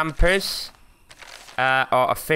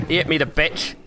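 ordinary talk

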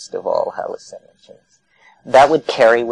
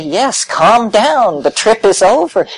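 mock reassurance; 10 semitones higher, 5 dB louder than usual. Soothing the tripper.

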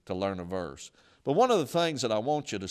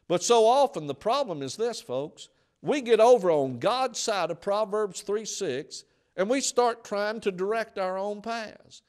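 high, loud pulpit preaching; fiery sermon climax; preaching at full force; 8 semitones higher, 6 dB louder than usual. Acted. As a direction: drive it home to the congregation.

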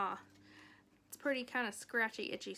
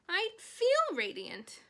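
loud, high and slow, beaming; proud pig; 9 semitones higher, 7 dB louder, slower than usual. Acted. Wilbur tells Charlotte he feels radiant, beaming. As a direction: savor every word of it.

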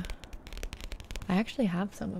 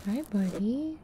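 pet talk; 8 semitones higher, 7 dB quieter, slower than usual. Real, not acted. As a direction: coo gently at the pet. Talking to her dog.

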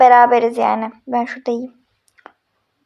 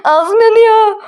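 burning mouth panic; 7 semitones higher, 4 dB louder, much slower than usual. Acted. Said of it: "[name] tövbe abartmadın mı?".

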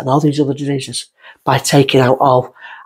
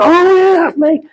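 sudden recognition; 4 dB louder, slower than usual. Retold or imitated. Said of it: "I go".

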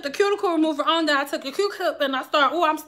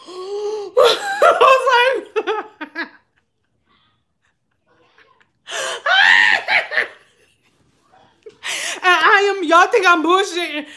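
high, shrieking helpless laughter; real hysterical laugh-cry; 6 semitones higher, 3 dB louder, slower than usual. Real, not acted. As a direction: laugh until it turns to wailing. Horrified laughing retelling.

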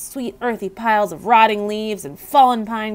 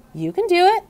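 much higher encouraging squeak; 11 semitones higher, 3 dB louder than usual. A narrator's reading. Hamster encouraging the custodian, high encouraging squeak. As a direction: cheer him on. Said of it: "I squeaked".

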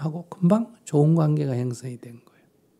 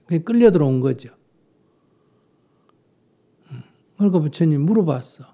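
normal talking voice

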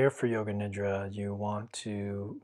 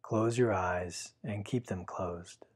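guided meditation. Acted. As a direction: guide the listener gently into stillness. Leads a Yoga Nidra meditation.

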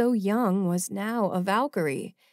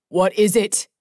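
blurted out; 7 dB louder than usual. A narrator's reading. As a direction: snap the question out fast. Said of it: "Jamin blurted".

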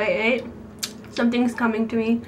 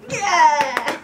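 excited cheer; 4 semitones higher, 5 dB louder than usual. Real, not acted. Wins the guessing round.

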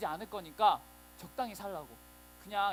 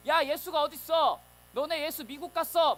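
loud, slow, jeering taunt, higher and louder; mocking taunt; 6 semitones higher, 8 dB louder than usual. Soldiers jeering at waiting Christians, loud mocking taunt. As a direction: sneer at the believers like a bully.

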